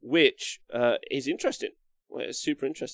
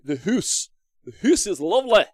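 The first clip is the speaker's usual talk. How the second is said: louder, higher mock Scottish lilt on hoose; Scottish accent; 9 semitones higher, 10 dB louder than usual. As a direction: put on a hammed-up Scottish accent. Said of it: "full of Scottish dwarves".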